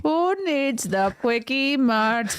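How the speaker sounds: put-on drawn-out singsong Indian accent, high at the end; hammed-up cartoon Indian shopkeeper; Apu impression. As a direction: sing it out slowly in a mock Indian accent. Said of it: "doing the voice of Apu".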